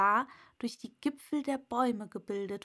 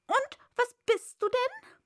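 high, loud bird voice; sharp pigeon interrogation; challenging pigeon; 13 semitones higher, 6 dB louder than usual. A narrator's reading. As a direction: demand an answer as an agitated pigeon.

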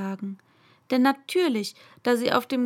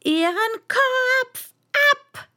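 high, loud, slow furious queen; bellowing; 13 semitones higher, 6 dB louder, slower than usual. Acted. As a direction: roar the order in rage. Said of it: "fing sie an zu brüllen".

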